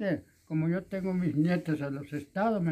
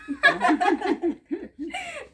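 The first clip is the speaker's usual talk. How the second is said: high delighted cackle; high cackling laugh; 13 semitones higher, 5 dB louder than usual. Real, not acted. Laughs at joke about dancing.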